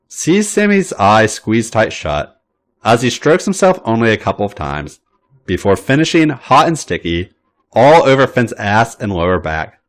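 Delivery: usual speaking voice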